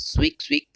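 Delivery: high, squeaky mouse imitation; mouse squeak. Presenter imitating a mouse for the class, high squeak. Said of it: "A mouse sways".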